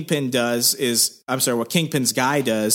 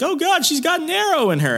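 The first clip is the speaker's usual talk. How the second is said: high-pitched mock alarm; retold panic; faster than usual. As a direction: act out a panicking doctor in high voice.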